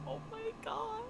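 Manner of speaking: high, overcome fangirl whine; near-crying wail. Retold or imitated. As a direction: whimper it as if moved to tears. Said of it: "Are you gonna cry now".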